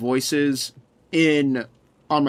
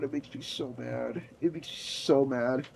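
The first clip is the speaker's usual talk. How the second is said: much softer, lower defeated mutter; deflated grumble; 6 semitones lower, 15 dB quieter than usual. Real, not acted. Streamer grumbling about himself, much softer low mutter. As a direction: sink into it quietly. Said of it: "oh my god".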